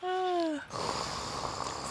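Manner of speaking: soft, drawn-out exhale; long sigh. Real, not acted. Host moving on to upcoming races, long sigh. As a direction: let out a long quiet sigh.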